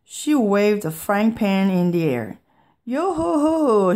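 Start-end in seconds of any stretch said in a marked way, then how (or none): 2.9-4.0 pirate voice. A narrator's reading. Pirate Mom bursts in.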